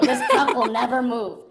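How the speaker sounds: laughing mock solemn vow; laughing repeat. Real, not acted. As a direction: relish the absurd promise.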